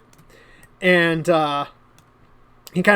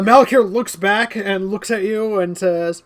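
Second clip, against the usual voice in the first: normal talking voice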